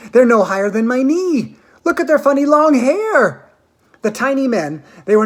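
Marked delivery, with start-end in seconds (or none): 0.1-3.4 higher and louder, full of wonder; excited marvelling. A narrator's reading. A visitor to the others, amazed. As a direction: gawk and point in delight.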